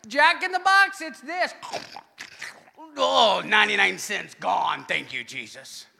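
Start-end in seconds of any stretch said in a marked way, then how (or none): none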